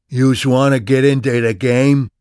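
brash Brooklyn hustler voice; thick New York accent. A narrator's reading. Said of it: "his lyrically thick accent".

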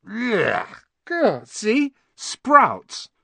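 high, loud yelps of disgust. Acted. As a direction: spit out the taste of sprouts. Ron bites a foul bean.

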